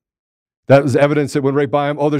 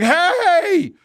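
cheeky evidence voice; 12 semitones higher, much slower than usual. Pretend. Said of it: "smashed the judge with evidence like".

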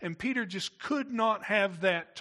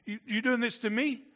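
higher and louder, protesting the master; a stung disciple pushing back; indignant; 6 semitones higher, 5 dB louder than usual. Retold or imitated. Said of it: "with something of an indignation".

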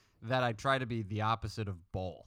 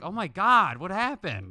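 loud, high mock shock; fake surprise; 6 semitones higher, 7 dB louder, slower than usual. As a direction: feign alarm, louder and higher. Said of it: "he's like".

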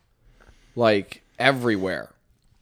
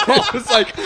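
higher and louder through a laugh; 10 semitones higher, 4 dB louder than usual. Real, not acted. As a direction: blurt it out while laughing. Laughing while starting a story.

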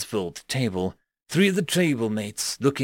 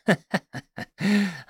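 dark chuckle; 7 semitones higher than usual. Acted. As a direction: chuckle darkly, sharklike. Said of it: "chuckled darkly".

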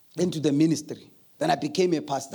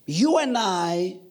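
preaching; 4 semitones higher, slower than usual. Acted. Preaching close.

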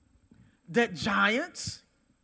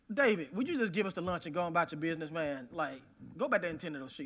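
quick, low, offhand scolding; mild dismissive brush-off; 8 semitones lower, 8 dB quieter, faster than usual. Retold or imitated. Brothers sending David back to his sheep, mild dismissive brush-off. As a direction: lightly imitate an impatient brother, low and quick.

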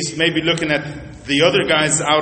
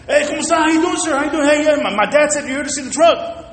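higher, eager welcome with a Spanish hello; excited Spanish greeting; 10 semitones higher than usual. Retold or imitated. Pastor imitating the salesman greeting the other guy in Spanish, excited. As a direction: gush over the customer in Spanish. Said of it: "In Spanish".

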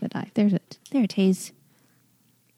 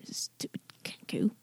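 whispered insult; 19 dB quieter than usual. Acted. Muttered under breath.

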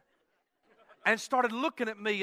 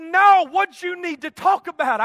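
imitated wife, high and forceful; loud insistent demand; 7 semitones higher, 10 dB louder than usual. Wife presses husband to say what's wrong, loud and insistent. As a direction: press for an answer, raised in pitch and volume.

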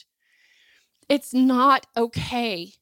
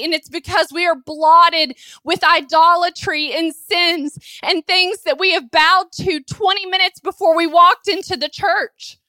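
raised, urgent pulpit voice; impassioned preaching; 3 semitones higher, 4 dB louder than usual. Real, not acted. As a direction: preach at full force, overwhelmed by God's holiness. Preaches at full force.

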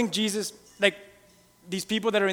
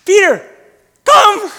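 loud, high calling out; Jesus calling Peter out of the boat; 10 semitones higher, 13 dB louder, much slower than usual. Retold or imitated. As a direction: call him out across the water. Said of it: "He says".

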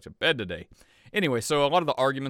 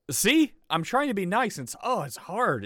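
exasperated; 8 semitones higher than usual. Real, not acted. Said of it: "It's so infuriating".

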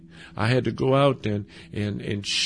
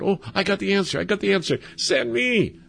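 higher, bursting eager student voice; like a kid with his hand up dying to answer; eager schoolboy; 6 semitones higher, faster than usual. Acted. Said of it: "a student in school raises his hand".

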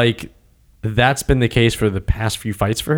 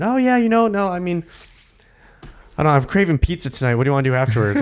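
casual chit-chat; 6 semitones higher, faster than usual. Retold or imitated. Fighter casually chatting mid-fight.

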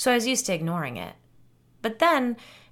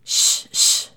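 sharp, forceful shush; loud shushing; 8 dB louder than usual. A narrator's reading. Woman on a recording trying to silence a roaring hum, loud shushing. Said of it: "you hear me say loudly".

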